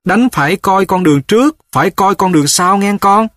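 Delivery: calling back advice. A narrator's reading. Mother walking off warns her son to watch the path. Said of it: "vừa đi vừa dặn giới lại".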